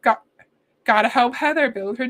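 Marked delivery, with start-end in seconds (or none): none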